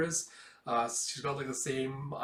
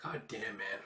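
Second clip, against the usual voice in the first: stunned murmur; 3 semitones lower, 7 dB quieter than usual. Real, not acted. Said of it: "reacting to heartbreaking scene".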